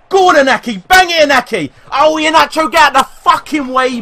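high, loud shouts at the players; frantic urging. Real, not acted. Gamer urging Inaki on and clearing Iheanacho away, frantic shouting. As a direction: yell them on towards goal.

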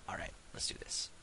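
focused whisper. Real, not acted.

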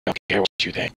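breathy hiss through teeth; raspy ghoul, like a throat infection. Acted. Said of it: "It's not that hard to actually do a ghoul voice".

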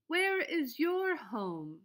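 high, slow little bunny question; bunny asking. A narrator's reading. Bunny asking the robin, high and slow. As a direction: ask gently like a small bunny. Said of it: "he asked the robin".

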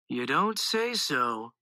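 slow, mildly solemn reply. A narrator's reading. Brother answers the proud announcement, mildly solemn. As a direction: draw it out with a touch of gravity. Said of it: "Rooch replied solemnly".

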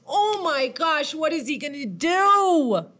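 high, excited astonishment; amazed. Pretend. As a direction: play up the big reveal for a child. Reader reacts to story's reveal.

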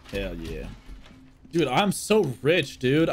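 usual speaking voice

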